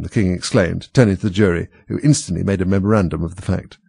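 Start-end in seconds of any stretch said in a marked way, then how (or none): none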